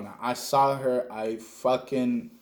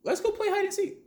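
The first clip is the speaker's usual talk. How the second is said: put-on eager kid idea; 13 semitones higher, 3 dB quieter than usual. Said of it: "I come up with the great idea".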